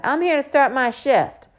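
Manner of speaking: put-on high, loud girl's call. A narrator's reading. Girl outside asking for Ebony Grace, calling out high and loud. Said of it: "a girl's voice calls from outside".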